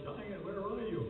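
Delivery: a high, lightly called-out mock yell. Little fellow calling for the giant, mild mock yell. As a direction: call up to the unseen giant, half-playful. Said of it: "And he yells".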